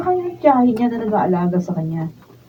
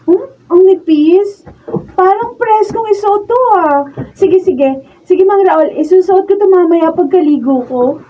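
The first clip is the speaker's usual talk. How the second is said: giddy delight; 7 semitones higher, 5 dB louder than usual. Acted. Girl delighted by nightwear.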